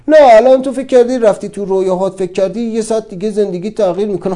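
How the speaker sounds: loud, high, sneering taunt; the mind jeering at his dream; mocking inner voice. The mind mocking him for daydreaming, loud and sneering. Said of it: "میگه".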